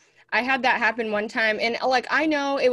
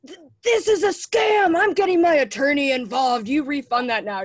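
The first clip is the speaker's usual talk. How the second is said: high, raised mock tirade; angry complaint; 3 semitones higher, 3 dB louder than usual. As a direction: act out bitching someone out over a scam. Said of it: "I'm bitching them out".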